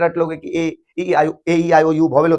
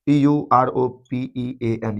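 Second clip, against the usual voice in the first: spelling aloud; 5 semitones lower, 4 dB quieter, much slower than usual. Shown as a demonstration. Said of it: "यहीं पर लगाएंगे European".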